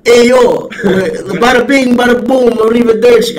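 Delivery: loud, high, hammed-up New York Italian; trashy Jersey Shore Italian accent, shouted high; mock New York Italian accent. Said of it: "Is that New York?".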